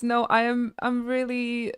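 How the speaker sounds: normal talking voice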